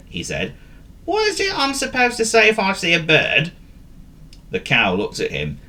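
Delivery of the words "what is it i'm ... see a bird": high-pitched dog asking for help; puzzled, high character voice; forgetful dog. Acted. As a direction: ask in a high cartoon dog voice. Forgetful dog asks the cow.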